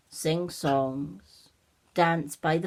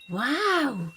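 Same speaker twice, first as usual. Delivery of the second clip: high, louder wow of wonder; amazed; 6 semitones higher, 5 dB louder than usual. Acted. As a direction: marvel out loud. Olivia amazed by fairy bells.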